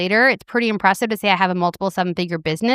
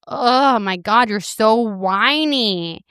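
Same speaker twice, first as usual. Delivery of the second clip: slow, exasperated mock scold; scolding herself; much slower than usual. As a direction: drag out the disgust at her own whining. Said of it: "I'm like".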